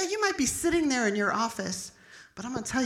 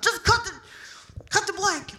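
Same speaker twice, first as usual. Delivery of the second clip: high, loud mock scolding; hammed-up angry yell; reading the riot act; 9 semitones higher, 3 dB louder than usual. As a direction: imitate an irate cop cutting someone off. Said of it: "giving me the riot act".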